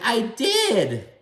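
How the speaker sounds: high-pitched mimicked protest; indignant retort. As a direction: imitate a defensive comeback, voice shooting up. Acts Teresa's protesting retort.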